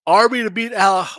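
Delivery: words stretched by a yawn. Real, not acted. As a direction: say it mid-yawn. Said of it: "excuse me, guys, I'm yawning here".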